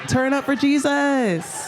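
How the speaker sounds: high, drawn-out rallying shout; mock youth-pastor hype cry; hyped youth pastor. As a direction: whoop it up for the congregation, slow and high.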